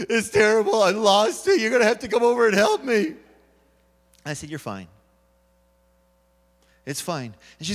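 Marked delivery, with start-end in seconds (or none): 0.1-3.2 louder, higher flustered pleading; stressed panic. Retold or imitated. Wife panicking to her husband about the translation, louder and flustered. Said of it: "she was so stressed". 4.5-7.3 much softer, low calm reassurance; reassuring. Retold or imitated. Husband soothing his stressed wife, soft and calm.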